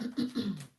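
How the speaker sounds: sharp throat clear. Real, not acted. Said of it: "let go of my throat chakra".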